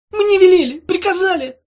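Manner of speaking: high, loud panicked squeal; cornered little creature blurting excuses; terrified creature. Acted. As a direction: plead in a tiny frantic voice.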